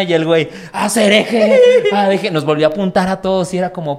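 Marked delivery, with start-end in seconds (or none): 0.7-2.3 alto, borracho y riendo; drunk bodyguard yelling. Retold or imitated. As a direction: grita como escolta pedo. Escolta borracho gritando. (escolta borracho gritando riendo)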